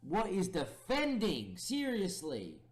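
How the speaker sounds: high, loud, slow disbelief; exasperated outcry. Real, not acted. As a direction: drag it out in despair at the defending. Disbelief at conceding a goal.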